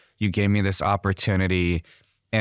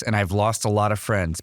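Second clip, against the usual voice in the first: usual speaking voice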